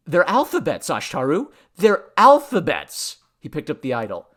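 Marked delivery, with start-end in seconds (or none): 0.1-3.2 louder, higher, mounting excitement; excited realization. A narrator's reading. Man realizing a discovery, excited. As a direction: repeat it harder as it dawns.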